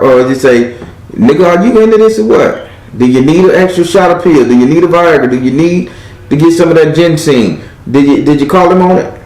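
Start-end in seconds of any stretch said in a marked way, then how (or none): 1.1-7.6 higher, teasing demand to her man; mock scolding girlfriend. Reader scolding her man about his libido, pitched up and teasing. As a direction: needle him about his pills, pitched up.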